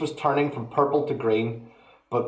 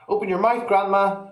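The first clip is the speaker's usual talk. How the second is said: put-on higher, louder boyish coaxing; 9 semitones higher, 4 dB louder than usual. A narrator's reading. George telling grandma to open wide, higher and louder. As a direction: coax like an eager lad with a spoon. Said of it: "he cried".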